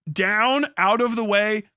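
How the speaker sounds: ordinary talk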